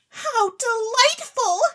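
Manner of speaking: very high, loud, slow squeal of delight; delighted exclamation. Acted. Claribel exclaims over the magic bonbons, high and thrilled. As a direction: gush with rapture. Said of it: "exclaimed Claribel".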